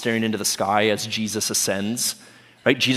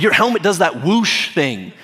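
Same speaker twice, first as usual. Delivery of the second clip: animated, higher-pitched pep talk; Woody; 6 semitones higher, 3 dB louder than usual. Acted. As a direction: hype up a friend in a lifted voice. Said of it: "Woody gives him this riveting speech".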